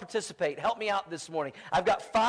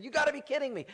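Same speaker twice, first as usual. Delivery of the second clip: higher, disbelieving; stunned daughter; 9 semitones higher, faster than usual. Retold or imitated. Daughter hearing the news from her brother, stunned. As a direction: pitch it up in disbelief.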